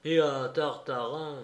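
ordinary delivery

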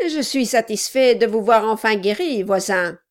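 a loud, pushy little man; gruff gravelly dwarf voice. Acted. Narrator voices the dwarf Quilp.

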